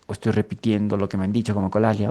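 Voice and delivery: ordinary talk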